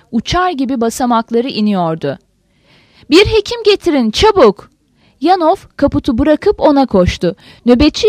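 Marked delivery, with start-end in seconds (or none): 3.1-4.7 high-pitched, urgent narrated order; urgent call. A narrator's reading. Aide rushing down the stairs for a doctor, urgent call. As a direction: call for help in alarm.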